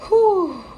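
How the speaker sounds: high, loud whew; heavy whew. Real, not acted. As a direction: blow out the emotion. Overwhelmed by the reading.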